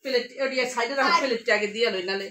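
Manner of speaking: normal talking voice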